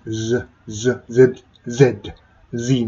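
Z held as a voiced buzz; repeated Z, voiced like a bee; buzzing Z demo. Shown as a demonstration. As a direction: buzz the Z, drilling it. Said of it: "Zの発音".

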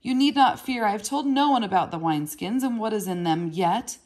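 high, loud blurted defiance; boy lashing out at his half-brother; angry outburst. A narrator's reading. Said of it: "he blurted angrily".

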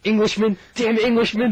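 loud cursing shout; mock outraged shout. Mock outrage at English kids.

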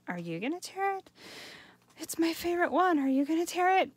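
high, worried asking of neighbours; mock nervous. Students ask neighbours if they'll tear, mock worried. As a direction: fret like a hesitant student.

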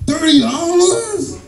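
high, drawn-out disbelief; high-pitched comic shock; incredulous outburst. Acted. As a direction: stretch it out high in mock outrage at the price. Acted outraged reaction to price.

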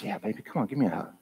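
put-on soft, gentle reassurance to a little girl; father comforting his daughter, tender and soft. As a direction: soothe her softly. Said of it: "I said".

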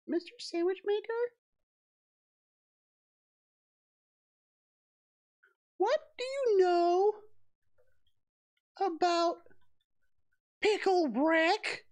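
high, slow sing-song voice; silly high voice. Pretend. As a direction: stretch it out in a goofy high voice. Continues the put-on voice.

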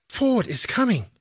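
high-pitched happy exclamation. A narrator's reading. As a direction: announce it with girlish delight. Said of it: "she said happily".

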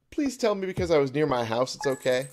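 loud, high, anxious begging; panicked plea. Real, not acted. Player hoping being near home counts, panicked plea. Pleading after time ran out.

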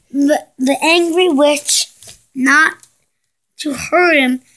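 plain reading voice

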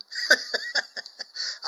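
breathy giggle. Real, not acted. Laughs after love you.

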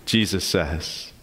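ordinary talk, nothing marked

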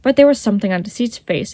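plain reading voice

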